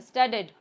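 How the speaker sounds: "स्टडीड" ending on a plain d; clear d sound. Shown as a demonstration. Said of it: "सिर्फ डी का ही sound".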